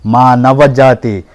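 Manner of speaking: loud, rolling mythological-film declamation; grand film dialogue. Acted. The human, defending humankind to Yama, declaims loudly like a film hero. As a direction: thunder it like a classic Telugu screen hero. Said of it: "నేను dialogue చెప్తా".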